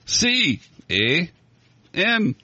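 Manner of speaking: high, punched letters with questioning "eh"; high-pitched, playful mock Canadian scoutmaster; joking scoutmaster. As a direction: call out the spelling as a joke punchline. Acts out scoutmaster spelling Canada joke.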